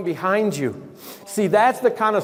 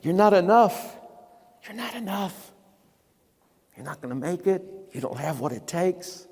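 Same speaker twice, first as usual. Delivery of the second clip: a hushed voice of doubt in his ear, much softer; whispered taunt; 6 dB quieter than usual. Retold or imitated. The enemy of your soul whispers defeat, hushed and soft. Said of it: "the enemy of your soul is whispering defeat".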